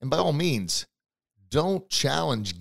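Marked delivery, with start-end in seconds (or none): none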